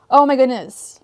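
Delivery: normal talking voice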